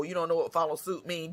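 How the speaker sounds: ordinary talk, nothing marked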